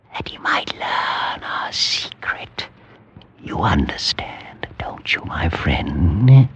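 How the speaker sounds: soft unvoiced whisper, slow at the end. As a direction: draw him close and breathe the secret. Paranoid secret to friend.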